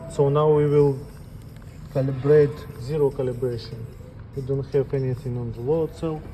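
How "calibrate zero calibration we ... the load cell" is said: ordinary talk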